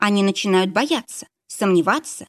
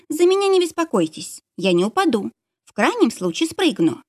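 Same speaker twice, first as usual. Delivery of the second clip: reassuring; 6 semitones higher than usual. A narrator's reading. Lisa reassures him.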